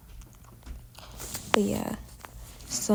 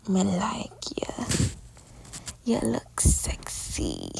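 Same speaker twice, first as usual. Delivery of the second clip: creepy man's come-on; slower than usual. Retold or imitated. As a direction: drag it out like a creepy older guy. Creepy older man hitting on her.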